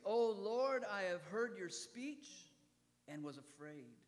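fervent prayer, loud start then falling away; prophet's prayer. Acted. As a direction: pray it out like the prophet. Preacher voices prophet's prayer.

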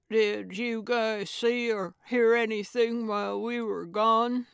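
talking through a mouthful of sandwich; mouth full. A narrator's reading. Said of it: "said through a full mouth".

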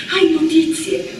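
high, urgent plea for news; anxious question. A narrator's reading. Miretta presses Dina in the crowd, anxious. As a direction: ask as if dreading the answer.

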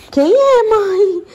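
high, loud coaxing question to a dog; pet talk. Real, not acted. Mother asking the dog who the baby is, high coax. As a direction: ask the dog up high and excited.

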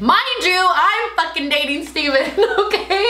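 high and loud, incredulous; indignant outburst. Real, not acted. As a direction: let the outrage burst out. Outraged aside to listeners.

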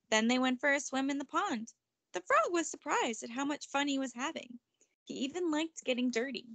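plain reading voice

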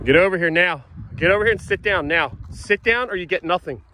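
loud, firm parental order; stern command. Real, not acted. Parent orders child back.

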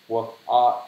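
ordinary talk

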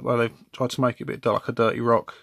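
ordinary delivery

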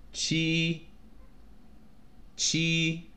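"七" held high and level; high first tone. Shown as a demonstration. Teacher marking tones on numbers, high level tone. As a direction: sustain "七" on one even high pitch. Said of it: "first tone".

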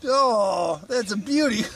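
high, laughing delight, slow. Real, not acted. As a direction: laugh through the words. Laughing at the gross result.

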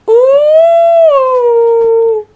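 loud high teasing squeal; loud high mock squeal. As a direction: squeal to tease the angry cook.

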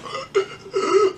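high choking gasp for air; imitated gasp. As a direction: act out gasping, unable to breathe. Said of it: "I started going like this".

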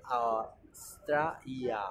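"Australia" very slowly as "A-stra-ia"; broken into sounds. Shown as a demonstration. Teacher sounding out the Y, slow "stra-ia". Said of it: "la Y griega".

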